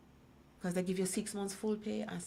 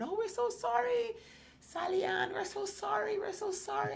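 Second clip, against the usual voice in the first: tearful sympathizers. Retold or imitated. Group consoling a woman after her story, mock tearful. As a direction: mimic their sorry chorus in a much higher voice. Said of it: "the tears flowed".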